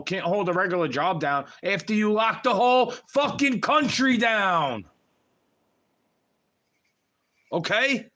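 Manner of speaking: building fury, dragging out the last words; heated rant. Real, not acted. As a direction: push it up into an outraged yell. Ranting about the lockdown, escalating.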